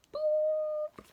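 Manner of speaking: a very high steady whistle tone; whistle noise. Acted. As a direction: toot it like a referee's whistle. Referee's whistle imitated.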